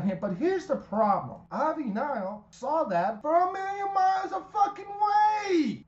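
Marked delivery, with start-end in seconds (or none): 1.5-5.8 high, loud exasperated yell; frustrated shout. Real, not acted. Host fumes at Ivy Nile missing the obvious attack, shouting. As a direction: bellow your disbelief at her. Ranting about a botched finish.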